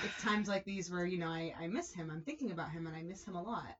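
soft, low, fading sorrow; a widow missing her husband, quietly; sad. Retold or imitated. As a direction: let it sink into a sigh. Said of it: "saddest expression".

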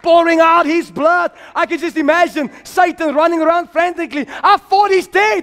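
high, shouted sermon climax; fervent high-pitched revival preaching; full-force preaching. Real, not acted. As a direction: build to a triumphant hallelujah shout.

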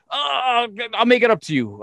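high, sheepish apology; mock sheepish promise. Mimicking Billy promising to make up the bounced pay, high and sheepish.

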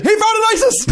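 very high, campy lilt; sassy Trump. Retold or imitated.

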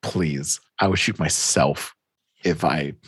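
low, quiet disdain; acted low-voiced dismissal; scornful denial. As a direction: play a mob boss dismissing the very idea. Mob boss scornfully denies alliance.

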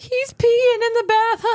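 put-on high little girl tattling; child voice. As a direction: squeal it like a kid.

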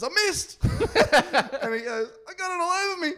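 high panicked whine. Retold or imitated. Friend's panicked report voiced.

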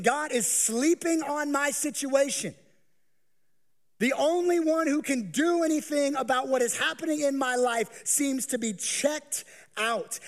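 high anxious complaint to God; voicing a worried believer. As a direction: plead like someone who feels abandoned. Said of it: "you're thinking".

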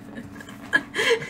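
high cackling witch laugh. Acted. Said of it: "Do your witch laugh".

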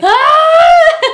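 very high, loud scream; piercingly high comic scream; re-enacted terrified scream. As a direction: shriek in mock terror, breaking into laughter. Acts out scream seen in photos.

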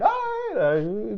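high, drawn-out dodge, imitating an official; mock evasive. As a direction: stall with a slow, high-pitched mock hedge. Mocking evasive official in imagined exchange.

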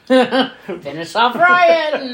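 slow, laughing exclamation. Real, not acted. Cohost laughing at the villains' plan, slow and amused. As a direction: spell it out through a laugh. Laughing repeat of plot point.

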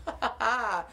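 normal talking voice

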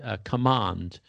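ordinary delivery

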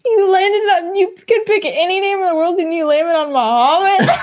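high-pitched mock disbelief, breaking into laughter; incredulous movie quote. Acting out Superbad's fake ID scene, incredulous. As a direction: imitate a baffled friend, cracking up. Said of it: "And he goes".

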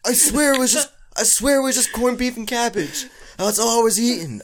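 put-on high, pleading old man lost on the phone. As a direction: whimper like a confused grandpa calling for help. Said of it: "can you do an old man, uh, voice right now?".

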